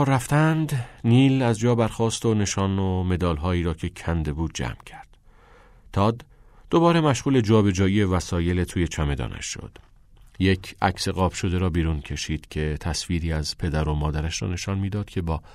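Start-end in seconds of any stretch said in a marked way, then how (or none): none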